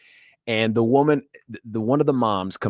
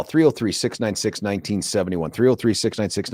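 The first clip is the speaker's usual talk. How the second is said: normal talking voice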